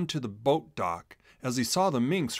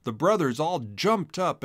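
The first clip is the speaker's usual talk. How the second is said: ordinary delivery, nothing marked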